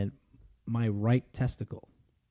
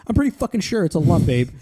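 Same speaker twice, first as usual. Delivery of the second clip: loud, higher mock outrage; indignant insistence; 5 semitones higher, 6 dB louder than usual. Husband insisting to his doubting wife about a lump, indignant.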